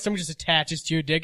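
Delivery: ordinary talk, nothing marked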